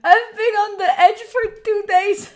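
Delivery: high, loud, tearful wail; trying not to cry. Real, not acted. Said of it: "don't cry. I, I'm, I am trying".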